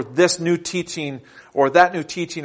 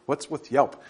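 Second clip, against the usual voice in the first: normal talking voice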